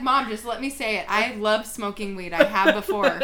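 imitated loud, stubborn protest; tripling down. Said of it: "started tripling down".

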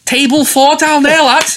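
high, loud, drawn-out Scouse call; Scouse accent. Retold or imitated. Restaurant gaffer calling a table to staff, broad Scouse accent. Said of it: "I don't wanna do a Scouse accent".

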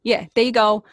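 cartoony Brooklyn accent, played up; broad Brooklyn accent, fond and admiring. Shown as a demonstration. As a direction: talk like an exaggerated Brooklyn local. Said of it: "I do Brooklyn. Does that count?".

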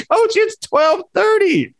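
high, drawn-out mock disbelief; incredulous protest. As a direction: stretch out a high-pitched, comic protest. Repeats the protest after coach insists.

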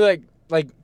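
ordinary delivery

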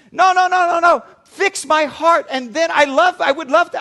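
high, loud whine to the doctor; whiny plea. Retold or imitated. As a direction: complain loudly like a lazy patient. Patient refusing doctor.

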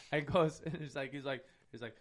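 ordinary talk, nothing marked

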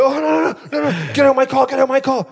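high, loud, repeated mock protest; retold angry protest. Acting out the row in the car for mates, high agitated protest. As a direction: re-enact a high-pitched, urgent ordering-out.

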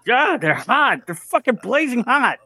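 high, strained squeal of pain. Acted. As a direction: yelp like the panties burn. Hot panties burn hands.

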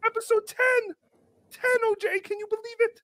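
high-pitched, gleeful opening squeal; excited show host. Acted. Host opens episode ten to the audience, excited high voice. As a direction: welcome the audience in a high, giddy voice.

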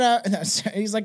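ordinary delivery

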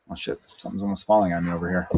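sudden soft fast aside as something tips over; startled aside. Real, not acted. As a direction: caught off guard, muttered off-mic. Object nearly falls on him.

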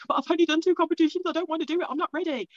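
high-pitched imitation of own whining; reluctant protest. Novice competitor objecting to stepping up to opens, mock whiny protest. Acted protest to her mentor.

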